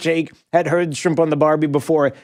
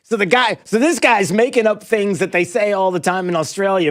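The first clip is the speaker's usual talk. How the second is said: usual speaking voice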